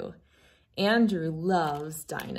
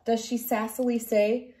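gentle storybook reading voice; reading to children; 2 semitones higher than usual. Acted. Reads picture book aloud.

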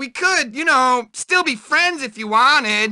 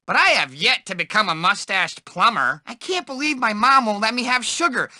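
ordinary talk

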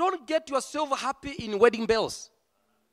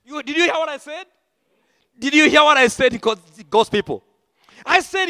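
loud, driving preacher's cry; full-force preaching; 6 semitones higher, 6 dB louder than usual. Real, not acted. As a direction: preach it out to the whole hall. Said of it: "Oh, yes, I'm preaching".

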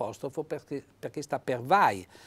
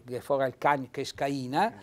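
usual speaking voice

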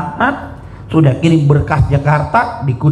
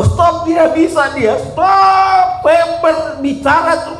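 preaching shout; 12 semitones higher, slower than usual. Acted. Preacher recalling tongues overflowing.

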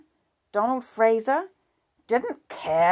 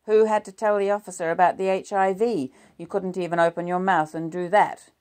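1 indignant; 3 semitones higher, 9 dB louder than usual. A narrator's reading. Husband hits back in quarrel.

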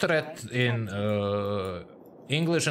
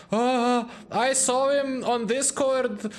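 higher, slow swooning voice; a hint of a starstruck fan; mock fangirl gush; 9 semitones higher, slower than usual. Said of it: "they're all like".

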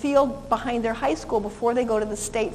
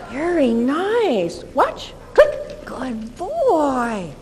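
cue and praise; 2 semitones higher, much slower than usual. Shown as a demonstration. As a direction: mark it and praise him. Rewarding dog in demo.

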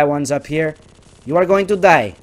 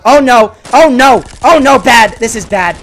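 high, loud repeated alarmed cries; panicked shout; 8 semitones higher, 11 dB louder than usual. Real, not acted. A gamer cries out as he loses a fight, alarmed. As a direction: cry out as the fight turns bad.

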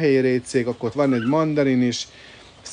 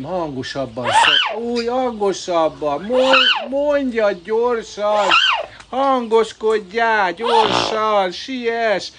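high, loud calls urging the swan on; 8 semitones higher, 3 dB louder, much slower than usual. Real, not acted. To the swan, begging it to complain: high loud calls.

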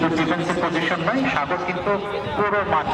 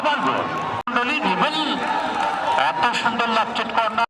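high excited commentary; excited goal call; 8 semitones higher than usual. Real, not acted. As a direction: ride the goal moment up high. Second goal called live.

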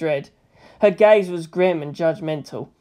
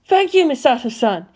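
higher, louder heartfelt thanks; honest rapture; 7 semitones higher, 4 dB louder than usual. A narrator's reading. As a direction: thank her overjoyed. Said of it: "with such honest rapture".

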